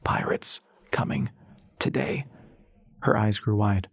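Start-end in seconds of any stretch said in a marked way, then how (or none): 0.0-2.3 slow, scratchy whisper, every syllable rasping; husky whisper. A narrator's reading. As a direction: whisper it through a dry, swollen throat. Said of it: "whispered in a husky voice that scratched".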